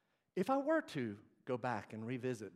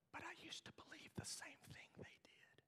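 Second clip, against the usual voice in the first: hushed, whispered admission; confessional whisper; 15 dB quieter than usual. Real, not acted. Preacher confiding his old belief to the congregation, whispered.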